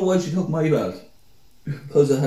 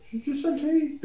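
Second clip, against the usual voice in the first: high mocking quote; 4 dB quieter than usual. Shane's reply quoted.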